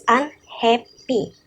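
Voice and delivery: slow, syllable by syllable; syllables spelled out. Shown as a demonstration. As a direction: split unhappy into un-hap-py slowly. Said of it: "And syllables".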